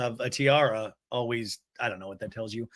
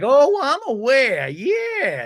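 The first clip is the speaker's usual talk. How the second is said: higher, louder, drawn-out imitation; emphatic mock retort; 10 semitones higher, 7 dB louder, much slower than usual. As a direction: mimic her with stretched, raised words. Mimics cast member's emphatic retort.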